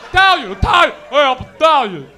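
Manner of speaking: very loud, proud hollering; shouting Italians. Retold or imitated. Comic imitates Italians yelling out their background, loud holler. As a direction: yell it like a rowdy Italian. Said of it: "I heard, like, three Italians".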